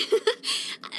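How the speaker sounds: soft, short breath of a laugh; light chuckle. Acted. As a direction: chuckle lightly after the compliment. Delighted by companion's cooking.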